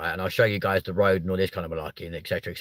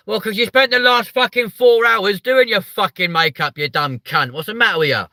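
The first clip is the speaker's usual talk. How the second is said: much higher and louder exasperated yell; hammed-up outraged shouting at a fool; mock furious rant; 11 semitones higher, 7 dB louder than usual. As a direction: tear into the crashed driver. Ranting at crashed makeup driver.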